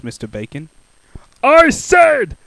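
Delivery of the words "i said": very slow loud high roar. Acted. Mister Noisy roaring at the butcher. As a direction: roar it out at full length. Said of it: "roared Mister Noisy".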